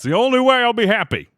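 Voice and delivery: high, loud, fast mock-decree; a bitter joke delivered big; sarcastic declaration. Real, not acted. Streamer jokingly demands donations, loud and mock-grand.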